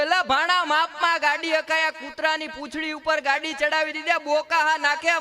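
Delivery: half-mock angry yelling at a friend; scolding shout. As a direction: scold your buddy for running over the dog. Scolding friend about dog.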